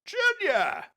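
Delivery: father's shout. Acted.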